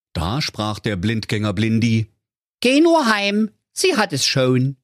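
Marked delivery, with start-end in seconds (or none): 2.6-4.8 very high, slangy creature voice; cheeky talking bomb. A narrator's reading. Talking bomb greeting the digger driver, very high cheeky voice. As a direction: greet him like a cocky youngster.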